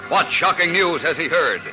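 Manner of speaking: ordinary delivery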